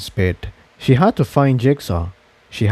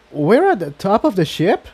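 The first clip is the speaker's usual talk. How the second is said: higher puzzled asking; confused question; 8 semitones higher than usual. Acted. Tiptoe, confused, asking where they have ended up. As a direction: sound lost and unsure. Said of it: "she asked".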